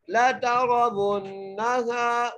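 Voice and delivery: very slow chant, thick ra in तरवुन्नल; nasal ghunna on the doubled nun; heavy ra. Shown as a demonstration. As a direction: recite ला तरवुन्नल जहीम melodically. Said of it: "वाव को मोटा करके".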